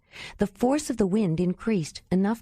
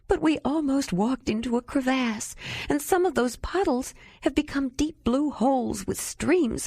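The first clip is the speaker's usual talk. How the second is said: high, teary voice pointing out danger; tearful protest; 7 semitones higher than usual. A narrator's reading. Tearful protest. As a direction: Ayla still crying, arguing with Jandalar. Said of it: "why I'm crying like this".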